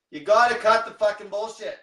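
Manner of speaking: sudden loud burst at the listeners; loud outburst. Real, not acted. Coach ranting at his followers, loud fed-up outburst. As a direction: bark it out hard, fed up.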